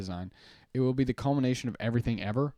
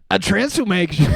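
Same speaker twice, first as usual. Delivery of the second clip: excited exclamation; 8 semitones higher, 9 dB louder than usual. Acted. Hyping his Foog transformation.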